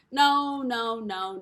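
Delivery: a sharp, loud "No" that slams the door shut; emphatic loud no. Real, not acted. Cuts off her own mockery.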